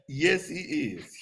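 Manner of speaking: slow, drawn-out agreement; slow emphatic. Real, not acted. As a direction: stretch each word.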